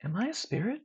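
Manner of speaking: high, soft whispered question; a hushed, wondering question. A narrator's reading. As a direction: breathe the question out. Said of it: "I whisper".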